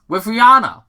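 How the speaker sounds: high, loud punchline; joking brag. Acted. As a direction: land the joke with a flourish. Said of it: "answers back in a joking manner".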